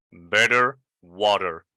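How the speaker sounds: "Better water" as "bedder wadder"; American flap t. Shown as a demonstration. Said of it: "Better or water in American pronunciation".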